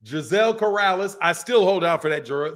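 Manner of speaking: normal talking voice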